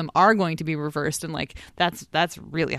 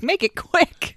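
high-pitched, insistent mock demand; mock urging; 12 semitones higher, 4 dB louder, slower than usual. Imitating the doctor hurrying things along, mock urging. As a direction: quote it high, pushing to hurry.

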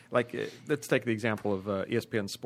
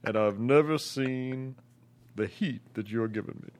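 Al Gore impression. Shown as a demonstration. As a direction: lay on the bar charm as Al Gore. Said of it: "singles bar Al Gore impression".

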